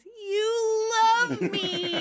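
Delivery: high, very slow gloating coo; sweet sing-song. A narrator's reading. Monica teases panicking boyfriend, sweetly. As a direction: stretch each word out sweetly up high. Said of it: "Monica sweetly says".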